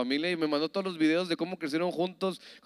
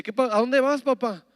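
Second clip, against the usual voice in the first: put-on higher, louder little boy's plea; child asking; 9 semitones higher, 8 dB louder than usual. Small boy asks his departing father, higher and louder.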